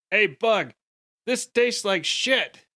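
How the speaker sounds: high, slow disgusted complaint; player character complaint. Acted. Tyler turning to his companions to complain, high and slow. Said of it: "I turn around to, to the other two and I".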